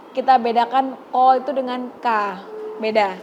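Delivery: usual speaking voice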